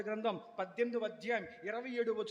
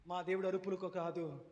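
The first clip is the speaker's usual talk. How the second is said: normal talking voice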